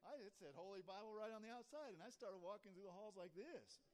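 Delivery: high, loud, unashamed showing-off; proud proclaiming. Acted. Teen parading his Bible through school, loud and proud. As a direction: announce it boldly to the whole hallway.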